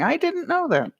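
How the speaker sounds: high, soft mock surprise. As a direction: squeak it out as if caught off guard. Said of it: "I'm going".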